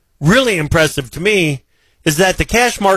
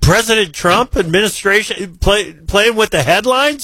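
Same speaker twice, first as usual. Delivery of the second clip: higher-pitched, playfully incredulous protest; mock disbelief; 6 semitones higher than usual. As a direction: tease the guest with pretend shock, pitch climbing. Host mock-shocked at guest's headline remark.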